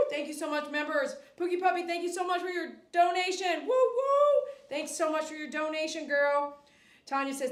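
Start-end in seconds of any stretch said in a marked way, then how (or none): 3.6-4.5 high, loud woo woo; excited cheer. Real, not acted. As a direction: cheer for the long-time member. Cheering a donation.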